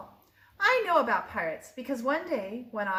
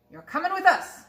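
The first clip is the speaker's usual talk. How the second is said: raised pirate captain voice; 7 semitones higher, 6 dB louder than usual. A narrator's reading. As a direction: order the boy aboard. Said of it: "Braid Bear told me".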